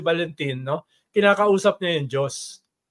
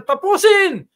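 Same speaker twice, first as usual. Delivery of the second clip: much higher, louder call "Tapusin!"; rally leader shout; 12 semitones higher, 7 dB louder, slower than usual. Retold or imitated. Salpanero rousing the protesters, high loud shout. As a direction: belt it out to rouse the crowd. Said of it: "Sisigaw siya ng".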